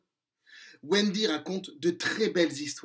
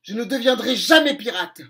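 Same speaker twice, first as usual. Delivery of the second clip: cri plus fort, défiant; cri déterminé; 3 semitones higher, 7 dB louder than usual. Acted. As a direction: crier son refus au pirate. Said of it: "crie-t-elle". (Wendy crie son refus à Crochet)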